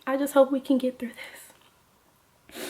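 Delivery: slow, whispered mock sincerity; hushed, hammed-up heartfelt plea; fake-solemn whisper. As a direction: whisper it slowly like a tragic confession. Acts out fake heartfelt confession.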